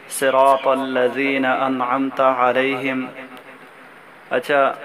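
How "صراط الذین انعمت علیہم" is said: slow recitation. Shown as a demonstration. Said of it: "slow demo recitation".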